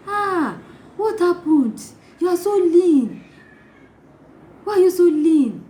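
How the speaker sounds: high, loud mock shock with drawn-out words; tactless exclaiming. Tactless visitor to new mother, exclaiming in shock. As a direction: exclaim it in exaggerated alarm, pitched high.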